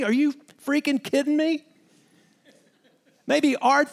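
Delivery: ordinary delivery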